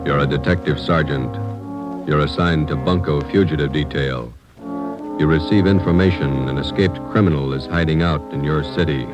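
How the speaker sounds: normal talking voice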